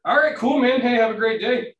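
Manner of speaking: mock breezy cheer. Landlord's cheerful brush-off.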